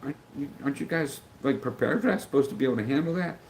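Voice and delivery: mildly indignant: surely you can handle this; incredulous complaint. Retold or imitated. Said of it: "I'm like".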